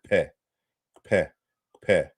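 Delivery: "kpe" with k and p released as one sound; "kpe" in isolation, a doubly articulated kp each time. Shown as a demonstration. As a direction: say "kpe" repeatedly, k and p together. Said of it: "It's a doubly articulated sound".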